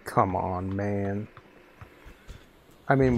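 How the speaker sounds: ordinary talk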